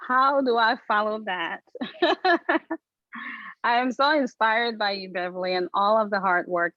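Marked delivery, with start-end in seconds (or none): 1.6-2.8 short, high-pitched real laugh. Real, not acted. Partner wonders how to follow that speech, high laugh.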